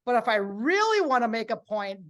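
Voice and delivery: diaphragm voice. Shown as a demonstration. Presenter demonstrating vocal support, projected. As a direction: push "really" up and out to make a point. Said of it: "gonna use my diaphragm".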